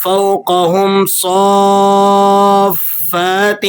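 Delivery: فَوْقَهُمْ with a thick ق and صَافَّاتٍ drawn out slowly; heavy qaf, slow. Shown as a demonstration. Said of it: "قاف کو آپ نے موٹا کر لینا ہے". (فَوْقَهُمْ میں ق موٹا، صَافَّاتٍ کھینچ کر)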